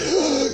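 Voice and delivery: high theatrical gasp of outrage; mock shocked gasp. Accuser catching a heretic, high mock gasp. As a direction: gasp like you're scandalised.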